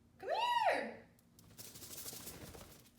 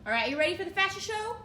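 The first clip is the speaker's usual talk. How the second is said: faster, louder and higher hype; excited announcer; 5 semitones higher, 5 dB louder, faster than usual. Real, not acted. Owner hyping her dog's fashion show, fast excited announcing.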